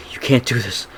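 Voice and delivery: lightly acted disbelieving protest; mildly voiced defiant plea; Homelander. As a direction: protest as if you can't be beaten. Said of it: "Homelander starts saying".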